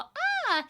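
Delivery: high delighted ah; joyful gasp. Retold or imitated. Heart going "ah" at happiness.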